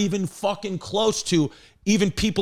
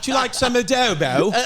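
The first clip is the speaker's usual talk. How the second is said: a high, light put-on British accent; lightly mock a posh British Puerto Rican; 7 semitones higher than usual. To friends, imitating a British Puerto Rican fan in a light British accent. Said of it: "Puerto Rican with a British accent".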